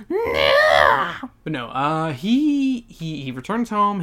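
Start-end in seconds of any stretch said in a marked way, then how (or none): 0.1-1.3 loud bratty wail; exaggerated petulant "nah"; mock tantrum cry. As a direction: bawl it out, hammed-up.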